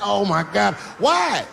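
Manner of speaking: high shocked shouting Why; shocked dad yelling. Retold or imitated. Dad yelling at his son in disbelief, high shout. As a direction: yell in disbelief at the kid.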